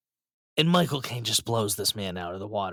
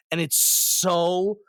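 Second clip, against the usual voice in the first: usual speaking voice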